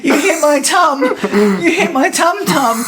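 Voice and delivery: put-on high, loud, babyish whine, laughing; whiny hurt man. Retelling the film, the beaten villain whining about his tummy. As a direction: whimper like a wounded child. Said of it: "he's like".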